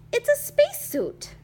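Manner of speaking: high, loud insistence; indignant correction. A narrator's reading. Kitty boy correcting Don Diego about his suit, indignant.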